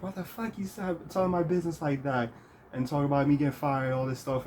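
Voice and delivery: high, light imitation of an upset soft talker; Yuki's soft-spoken outrage. Former friend ranting about getting fired, high soft-spoken outrage. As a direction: complain indignantly in a high, mimicked voice. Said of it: "still had that soft spoken voice".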